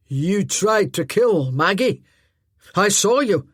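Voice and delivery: slow, loud accusing voice; cold accusation. Acted. Druston accusing Glynis of trying to kill Maggie, slow loud and cold. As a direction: accuse her, hard and deliberate.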